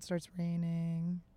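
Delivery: slow, drawn-out meditation guide; guided meditation. Retold or imitated. Guiding someone to imagine being a mountain, retold meditation voice. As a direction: stretch each phrase out, lightly imitating a guide. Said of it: "it's a guided meditation".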